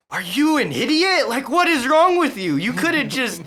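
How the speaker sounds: high, loud imitation of a berating sergeant; in-your-face sergeant. Sergeants get in a trainee's face, mock angry yelling. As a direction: chew out a trainee in mock fury. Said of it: "getting in my face".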